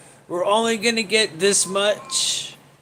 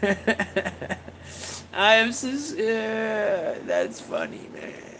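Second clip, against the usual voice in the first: quiet laughing fade; 7 dB quieter, much slower than usual. Real, not acted.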